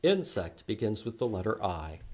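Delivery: ordinary talk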